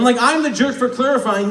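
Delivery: ordinary talk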